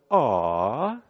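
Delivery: high, slow, sugary cooing; mock-sweet aww voice; mocking sentimental Canadian. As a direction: coo it like a sappy greeting card. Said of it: "as Canadians, we'd go".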